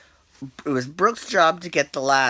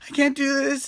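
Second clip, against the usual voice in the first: high mock whimper, much higher; mock scared; 11 semitones higher than usual. Mocking Scott freaking out at the bungee, high mock fear. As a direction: ham up the panic. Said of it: "He's like".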